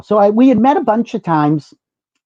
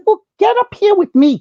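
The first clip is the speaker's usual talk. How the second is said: much higher, bright call; eager invitation. Retold or imitated. To Diahann in line, eager high call. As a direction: wave her up to the front. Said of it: "And I said".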